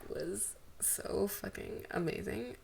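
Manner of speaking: hushed, slow and breathless; awed whisper. Real, not acted. Reader whispering awe after finishing the fic, slow and hushed. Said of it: "Wow, that".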